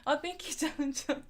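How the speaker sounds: ordinary talk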